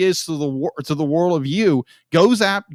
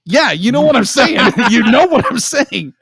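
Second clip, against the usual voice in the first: laughing gush; 7 semitones higher, 7 dB louder than usual. Real, not acted. Laughs while gushing about Suzu.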